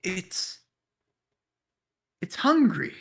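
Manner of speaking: high-pitched in-character report; Sildar's voice. Acted. Sildar telling the party of a whispering, halting. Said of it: "So he's like".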